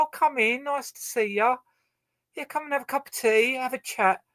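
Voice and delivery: high, cheery welcoming host voice; mock hostess inviting you in. Host inviting the guest in for tea, high and cheery. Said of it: "They go".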